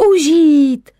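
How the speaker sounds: loud, high joyful cry; children's shout. Acted. Children answering mother's question, loud joyful shout. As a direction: shout it out together like happy kids. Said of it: "zvolali děti".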